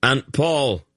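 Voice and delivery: high urgent yell. A narrator's reading. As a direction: call out across the fight. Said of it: "Gerion yelled".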